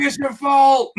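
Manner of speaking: loud, high mock wail of blame; mock crying. Guest jokingly blames the host on Instagram, mock crying shout. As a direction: shout it like a joking cry-baby, laughing. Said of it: "me on Instagram crying like".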